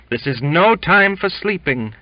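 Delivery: high and loud, leaning hard on no; exultant. A narrator's reading. Cook repeats his rebuke to Jimmy, exultant and loud. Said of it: "he repeated with exultation".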